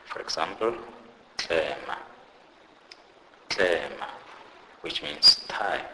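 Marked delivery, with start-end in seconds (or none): 1.4-4.1 "tlema" with TL said like the CL in clerk; "tlema", opening on a CL-like sound; TL sound demonstrated. Shown as a demonstration. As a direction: teach "tlema" as a separate, careful model word. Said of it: "pronounced like the C L in the word clerk".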